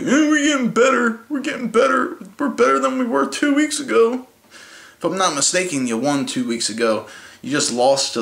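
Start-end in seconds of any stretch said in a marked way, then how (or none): 0.0-4.3 much higher, sing-song mock coach voice; mocking Harbaugh. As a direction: parody the coach's smug claim of progress. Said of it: "Instead of like".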